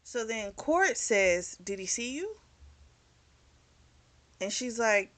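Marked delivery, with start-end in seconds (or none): 1.6-2.4 softer, higher, anxious asking; hushed worried question. Retold or imitated. A friend anxiously checks if she was seen, hushed. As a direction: ask it under your breath, worried.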